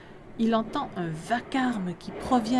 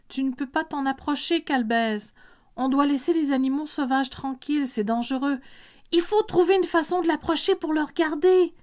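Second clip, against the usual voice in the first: higher, pleading mother voice; mother's voice; 6 semitones higher, 3 dB louder than usual. Acted. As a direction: voice the worried mother, rising higher. Mother calming her excited child.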